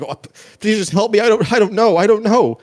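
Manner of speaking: shackled man begs the players; panicked pleading. Acted. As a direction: cry for help in terror.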